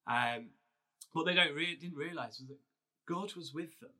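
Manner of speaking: normal talking voice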